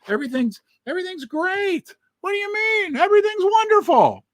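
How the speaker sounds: high, breezy sarcasm, everything is just wonderful; sarcastic mock cheer. The vlogger mocks officials who insist nothing is wrong, in sarcastic high cheer. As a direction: overplay the carefree optimism with a sneer.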